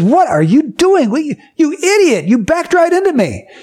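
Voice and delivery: high, outraged yell at the careless driver; angry guy yelling at the driver who dented his car. Retold or imitated. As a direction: shout like a furious man whose car just got hit. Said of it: "and he's mad".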